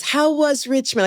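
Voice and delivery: a hint of a chirping, expectant mother; lightly voiced mom. Her mom asks after interview, retold.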